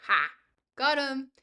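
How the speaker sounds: loud, gleeful gotcha; triumphant shout. Real, not acted. Streamer crowing at chat after catching someone out, loud triumph. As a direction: crow over catching him out.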